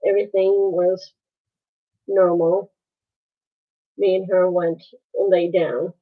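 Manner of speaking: ordinary talk, nothing marked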